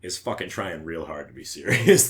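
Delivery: normal talking voice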